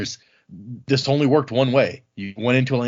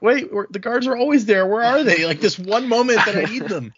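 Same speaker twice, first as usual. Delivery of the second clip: mock panic; 9 semitones higher, 3 dB louder than usual. Panicked prisoner voiced in retelling.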